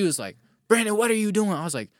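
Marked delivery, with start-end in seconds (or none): 0.7-1.5 higher, louder mock demand from Jen; angry demand. Imitating Jen on the phone, angry demand. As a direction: imitate Jen confronting him, raised and sharp.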